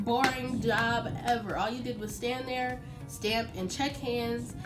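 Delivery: ordinary delivery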